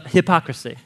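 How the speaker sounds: ordinary delivery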